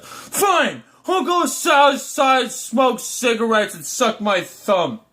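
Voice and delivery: high, whiny shout that sinks to a slow sulk; hammed-up bratty huff; petulant sulk. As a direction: stomp off like a pouting child. Sulky adult answers the comforter.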